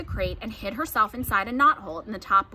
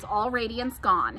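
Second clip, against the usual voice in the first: ordinary delivery, nothing marked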